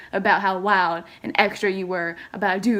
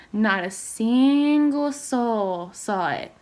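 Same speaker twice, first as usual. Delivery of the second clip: usual speaking voice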